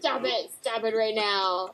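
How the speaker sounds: soft, slow, worn-out plea; mock exasperation. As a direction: beg them quietly to quit. Retold plea to name quoters.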